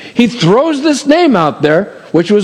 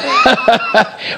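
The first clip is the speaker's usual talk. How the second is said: short high burst of laughter; real laugh. Real, not acted. Pastor laughing about people going cold on him, real laugh. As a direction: laugh at how people shut down.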